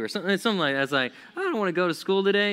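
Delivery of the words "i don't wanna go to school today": put-on higher, quick kid protesting school; mock childish protest. Kid to parent on a school morning, mock protest.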